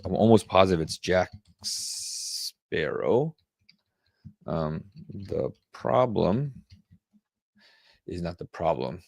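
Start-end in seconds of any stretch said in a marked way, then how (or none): 5.1-9.0 reading it off the screen word by word, slow and soft; slow, half-muttered reading; slow read-aloud. Shown as a demonstration. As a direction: spell it out slowly as you find it. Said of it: "Let me Google this".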